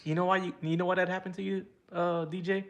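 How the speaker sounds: high, loud playful ribbing; gloating mock payback; teasing. Real, not acted. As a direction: razz your buddy for laughing at you. Teasing DJ on stream.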